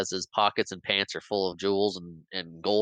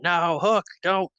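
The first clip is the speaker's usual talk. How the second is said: high, loud pleading yell; Peter yelling; 9 semitones higher, 5 dB louder, slower than usual. Retold or imitated. Peter yelling at Hook to stop the stab, pleading shout. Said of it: "we hear Peter yelling".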